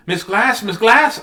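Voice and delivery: high, urgent acted call; crying out. Sick girl to teacher on bus, crying out. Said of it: "I cry".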